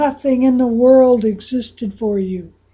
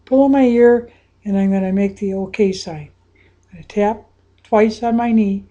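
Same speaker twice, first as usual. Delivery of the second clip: usual speaking voice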